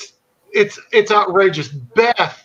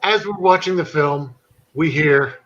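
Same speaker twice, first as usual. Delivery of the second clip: usual speaking voice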